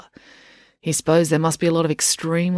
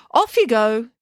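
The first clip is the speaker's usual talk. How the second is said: put-on bossy girl command; 7 semitones higher, 3 dB louder than usual. Loretta sends him off.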